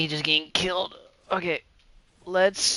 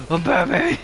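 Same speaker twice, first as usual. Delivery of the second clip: put-on drunk Batman; 6 dB louder than usual. Acted drunk Bruce scene.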